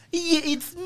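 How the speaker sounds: lightly mocking Italian accent, pitched high; playful hint of a cartoon Italian accent; mock Italian accent, high and teasing. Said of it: "it's just stereotypical".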